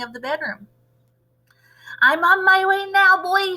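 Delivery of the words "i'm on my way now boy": high, loud crone calling down. Acted. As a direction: call down to the boy below. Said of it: "she called down to George".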